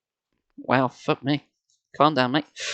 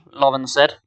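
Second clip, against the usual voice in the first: plain reading voice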